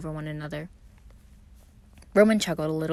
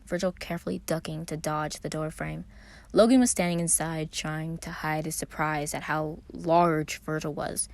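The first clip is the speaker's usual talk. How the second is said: usual speaking voice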